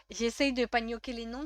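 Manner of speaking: ordinary delivery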